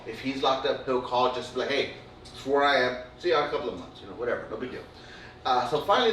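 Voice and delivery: normal talking voice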